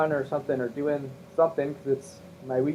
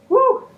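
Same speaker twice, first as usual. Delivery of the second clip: yelp; 9 dB louder than usual. Acted. Closing the impression bit.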